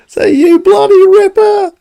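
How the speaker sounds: high, slow hammed-up yell of a tradie; mock Aussie shout. Imitating the drain cleaner shouting at his drain, high mock yell. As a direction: shout it like the cheery Aussie plumber. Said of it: "like, shouting".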